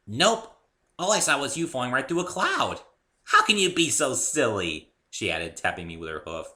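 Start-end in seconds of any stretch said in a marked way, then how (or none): 0.1-4.9 higher, louder playful pony; higher, louder playful pony tease; teasing pony. A narrator's reading. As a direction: tease him like a giggly pony.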